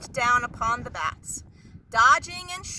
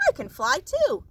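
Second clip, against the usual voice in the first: thought aloud; 3 semitones higher than usual. A narrator's reading. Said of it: "thought Stella Luna".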